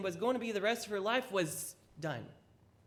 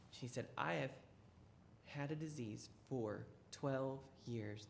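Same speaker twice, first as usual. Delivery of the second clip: softer, lower, hesitant plea; timid confession; 6 semitones lower, 9 dB quieter than usual. Acted. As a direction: confess shyly, afraid to be heard. Timid woman confesses to Jesus.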